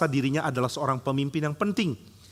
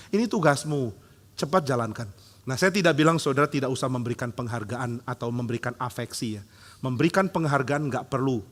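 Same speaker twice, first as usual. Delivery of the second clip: ordinary talk, nothing marked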